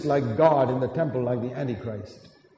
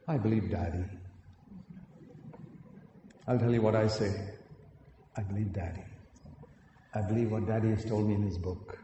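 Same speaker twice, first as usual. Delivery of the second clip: hushed, lower, earnest little voice; soft little boy; 4 semitones lower, 8 dB quieter than usual. Retold or imitated. Little boy answering his older brother, soft and earnest. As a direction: let the child quietly trust his father.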